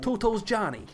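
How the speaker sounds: put-on New York accent. Host repeating the gangster nickname in a New York accent. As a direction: punch out the nickname like a cartoon gangster. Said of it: "that's the New York accent".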